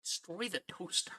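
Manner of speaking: very soft, with popped T's in "toaster"; whispery, emphasized T's. Retold or imitated. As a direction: drop right down and hit both T's of "toaster". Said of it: "so much emphasis on the T's".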